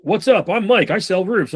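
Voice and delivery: high, chirpy salesman pitch; hammed-up cheesy door-to-door seller; pushy salesman. Salesman mocking the pitch at the door, high and chirpy. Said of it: "'cause you're just like".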